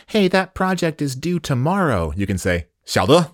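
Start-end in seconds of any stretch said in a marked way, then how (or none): 0.1-2.1 lightly voiced coworker, pitched high; a hint of a coworker, higher pitch; higher-pitched quote of a coworker. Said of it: "Like your coworker says". 2.8-3.3 peppy, higher-pitched quick reply; upbeat got-it reply. Shown as a demonstration. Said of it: "like a peppy way to say".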